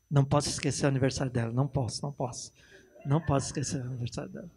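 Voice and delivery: mock a husband forcing himself to remember; anxious husband.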